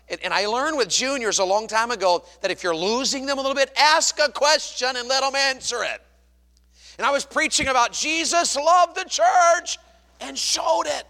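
loud and high, stretching the key words; full-force preaching. Real, not acted. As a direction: belt it out to the congregation. Preacher telling it loudly.

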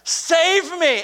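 high, loud drowning man's plea; desperate cry for help. Acted. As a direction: call out for rescue, drawn high. Peter cries out while drowning.

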